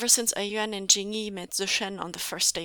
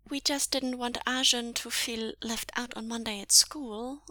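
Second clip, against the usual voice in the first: usual speaking voice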